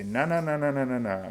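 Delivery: hammed-up kid plugging his ears; mock childish na-na. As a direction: tease like a kid refusing to listen. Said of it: "plug your ears and say".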